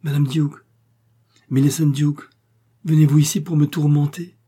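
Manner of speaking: high, loud, forceful accusation; energetic reproach. A narrator's reading. Sick man reproaches his married cousin, energetically. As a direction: hurl the name, building in force. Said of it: "il reprit avec énergie".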